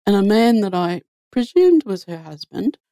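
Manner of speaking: ordinary talk, nothing marked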